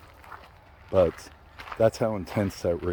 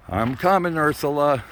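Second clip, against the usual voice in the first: calling out; 7 semitones higher, 4 dB louder than usual. Real, not acted. Calling to granddaughter ahead.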